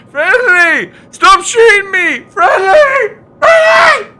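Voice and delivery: high, loud, whining pleas; mocking whiny player. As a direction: shriek it like a panicking, whining gamer.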